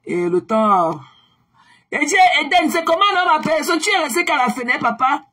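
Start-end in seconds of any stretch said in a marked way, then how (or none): 1.9-5.2 teasing call-out. Real, not acted. Teasing viewers lurking at window.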